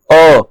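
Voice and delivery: "अ" said with the mouth fully open; a full, open-mouthed "अ" on its own; open-mouthed vowel demo. Shown as a demonstration. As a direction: open wide on "अ" for a learner to copy. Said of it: "मुंह पूरा खुलना चाहिए".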